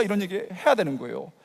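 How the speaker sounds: ordinary talk, nothing marked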